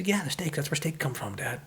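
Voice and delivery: put-on high-pitched matter-of-fact kid; high little-boy voice; young child. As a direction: imitate a little boy explaining to his father. Young son explains to father.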